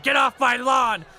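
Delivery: hammed-up shout at trespassers; mock scream. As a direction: holler it slowly like a heckler. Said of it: "Booing is screaming".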